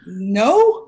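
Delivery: loud, laughing flustered reply; sheepish loud no. Retold or imitated. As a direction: blurt it out, caught off guard. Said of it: "I was like".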